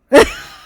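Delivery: a sudden very high loud burst of laughter; shrieking laugh. Real, not acted. Laughing at the desk lady taking a break at her desk, a loud squeal.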